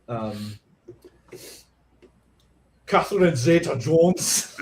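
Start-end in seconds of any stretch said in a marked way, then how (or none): 2.8-4.6 slow Scottish film-star impression; slow, hammy Scottish actor voice; Sean Connery. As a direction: draw it out like a Scottish screen legend. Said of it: "I can voice Sean".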